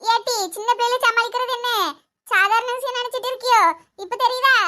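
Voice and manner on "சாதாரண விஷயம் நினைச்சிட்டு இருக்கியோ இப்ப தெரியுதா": ordinary delivery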